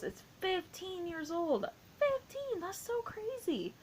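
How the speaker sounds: high, drawn-out incredulous squeal; real disbelief. Real, not acted. Fan can't get over Mean Girls' age, high-pitched disbelief. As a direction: marvel at it in a thin, high voice.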